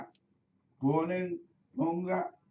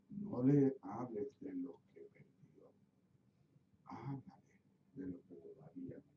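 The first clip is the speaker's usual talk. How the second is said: Spirit's inner voice; 6 semitones lower, 8 dB quieter than usual. Retold or imitated. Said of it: "el Espíritu me dice en mi corazón".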